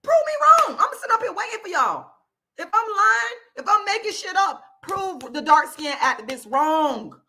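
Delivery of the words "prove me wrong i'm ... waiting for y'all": building from firm to high, loud demand; rising defiant challenge. Real, not acted. Ranting at viewers, escalating to a loud demand. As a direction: dare them louder each time.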